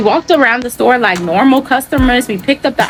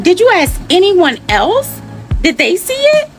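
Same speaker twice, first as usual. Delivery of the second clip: incredulous; 10 semitones higher than usual. Retold or imitated. Confronting clerk, retold.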